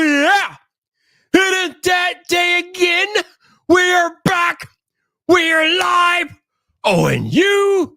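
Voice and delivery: high, shouted announcer intro with stretched-out words; hype show opening. Acted. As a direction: belt out a live show welcome with long whoops. Performs the show's opening.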